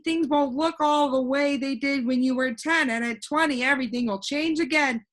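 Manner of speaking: put-on a cheerful know-it-all boy floating in the air; voiced boy Alec. Alec explains growing down.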